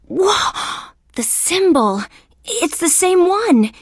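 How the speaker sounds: high, gasping astonishment; stunned, voice leaping up at a discovery; startled shock. Acted. Detective suddenly spots familiar symbol.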